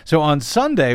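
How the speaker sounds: ordinary delivery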